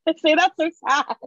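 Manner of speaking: giggling through the words; laughing. Real, not acted. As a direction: laugh it out, high and loud. Laughing at her own sign.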